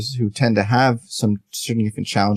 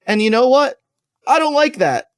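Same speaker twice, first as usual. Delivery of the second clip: voicing a blunt complaint; 11 semitones higher than usual. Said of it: "to say".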